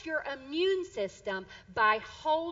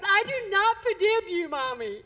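high, loud mock toddler indignation, easing at the end; upset little boy; 6 semitones higher, 6 dB louder than usual. Little son refuses mom's apology, mock upset. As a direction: mimic a small child's stubborn baby talk. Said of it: "he was so upset".

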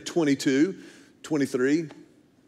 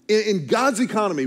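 normal talking voice

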